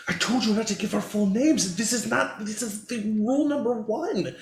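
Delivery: high-pitched, indignant scolding voice; scolding Grumpy. Acted. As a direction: lay down the law, pitched high. Game master voices Grumpy scolding Skyhawk.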